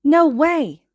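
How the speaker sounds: amazed disbelief. A narrator's reading. Little boy cries out in amazement. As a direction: blurt out your amazement.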